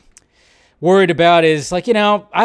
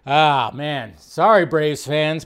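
normal talking voice

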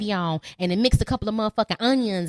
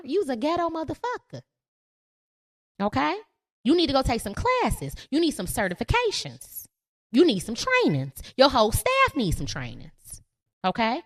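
normal talking voice

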